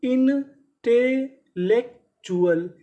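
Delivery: Intellectual spelled out in slow parts; very slow syllable by syllable. Shown as a demonstration. Teacher to students, dictating the word very slowly. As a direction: pull intellectual apart slowly for the class. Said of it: "तो पूरी meaning".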